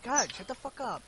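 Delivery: ordinary talk, nothing marked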